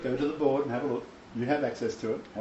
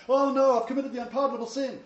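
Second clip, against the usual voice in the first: high, hurried fretting, hammed up; mock panic; 9 semitones higher, faster than usual. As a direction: imitate an overanxious man panicking. Voices an overanxious man's panic.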